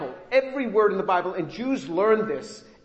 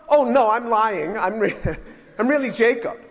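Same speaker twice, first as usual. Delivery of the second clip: mock confession; 3 semitones higher, 5 dB louder than usual. Jacob absurdly owning up to Isaac, mock confession. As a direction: confess loudly as a joke, chuckling midway.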